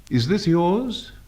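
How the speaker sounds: slow question climbing high; upward glide. Shown as a demonstration. Teacher asking a yes or no question, upward glide. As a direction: glide up to the end of "Is this yours". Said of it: "Notice the upward glide on a simple question".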